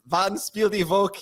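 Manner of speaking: put-on slow, careful German accent; mock German. Host naming the game of the week in slow German. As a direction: play it as practiced German words. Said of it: "he's already practiced it".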